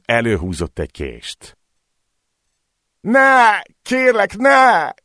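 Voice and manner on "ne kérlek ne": much higher, louder terrified begging; desperate pleading. Acted. Man on the ground begging the knifeman, terrified shriek. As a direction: beg for your life, shrieking. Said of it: "könyörgött a földön fekvő férfi".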